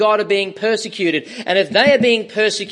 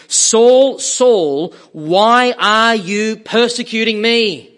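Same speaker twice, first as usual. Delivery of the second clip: loud call; 4 semitones higher, 6 dB louder, slower than usual. Acted. Sermon voicing the Lord's call.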